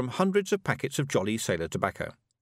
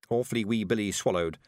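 ordinary delivery, nothing marked

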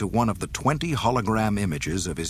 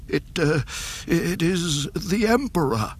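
higher, shaky frightened officer; trembling voice; 9 semitones higher than usual. A narrator's reading. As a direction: stammer it out in fear. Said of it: "The Admiral's trembling voice shook".

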